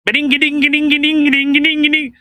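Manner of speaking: fast, high sing-song babble; mocking Jagmeet Singh. Host mocking Jagmeet Singh's speech, fast high sing-song babble. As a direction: rattle off a mock accent babble. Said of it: "I just spoke like him".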